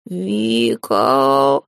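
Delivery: low, long drawn-out moan of a name; wailing cat. A narrator's reading. Cat flies to his mistress for help, low wail.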